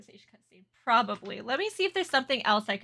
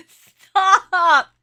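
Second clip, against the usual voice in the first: high squeal through laughter; laughing shriek; 8 semitones higher, 11 dB louder than usual. Real, not acted. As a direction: cry it out, cracking up. Laughing outburst.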